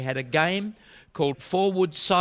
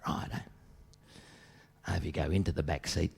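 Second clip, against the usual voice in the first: lower, soft and casual; quiet lowered-voice chat-up; young fella; 7 semitones lower, 7 dB quieter than usual. Acted. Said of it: "this young fella".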